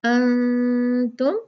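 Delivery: अंतुम slow, the न hidden and nasal; ikhfa on nun. Shown as a demonstration. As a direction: hide the न in अंतुम. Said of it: "यहाँ इख्फा होगा". (अंतुम में नून का इख्फा)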